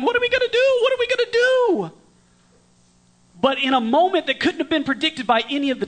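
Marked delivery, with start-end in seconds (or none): 0.0-1.9 high, loud panicked yelling; hammed-up frantic cry, much higher and louder; mock panic. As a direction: flap like a panicking disciple. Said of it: "panicking and yelling".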